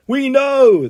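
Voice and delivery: high, drawn-out yell. A narrator's reading. Crowd of Andys answering in unison, yelling. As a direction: holler it like a whole crowd. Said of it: "they yell in unison".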